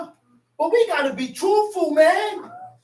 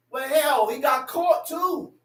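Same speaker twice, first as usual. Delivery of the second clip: ordinary delivery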